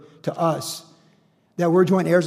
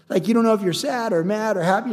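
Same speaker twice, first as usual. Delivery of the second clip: ordinary talk, nothing marked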